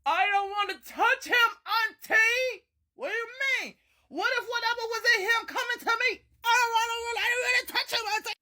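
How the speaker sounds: high, loud scared pleading; scared whining. Retold or imitated. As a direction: whine like a frightened child. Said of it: "The oldest auntie looked at me".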